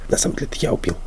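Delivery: whispered confession; conspiratorial whisper. Retold or imitated. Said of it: "если чувак подмигивает и просто говорит". (заговорщицкий шёпот)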